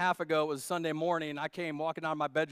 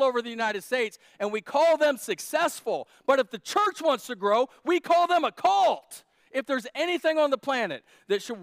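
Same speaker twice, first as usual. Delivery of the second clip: rising, louder preacher's shout; preaching at full force; 5 semitones higher, 7 dB louder than usual. Real, not acted. Pastor thundering at the congregation, loud and rising. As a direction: build it to a shouting peak.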